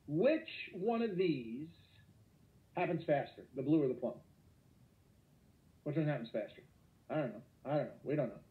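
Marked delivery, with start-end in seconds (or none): none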